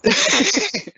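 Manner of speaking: high-pitched burst of laughter. Real, not acted. Laughing at friend's cheeseburger joke, high burst.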